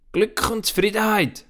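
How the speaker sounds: granny revealing the secret kindly; grandmother answering. Acted. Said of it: "sagt das Grossi".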